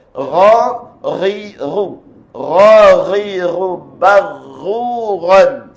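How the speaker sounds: Ra, ri, ru in turn, thick ghain, barruran drawn out; model recitation. Shown as a demonstration. Teacher models the reading for learners, measured recitation. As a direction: chant Ra, ri, ru, then stretch barruran. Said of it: "Kita tengok iaitu bacaan dia".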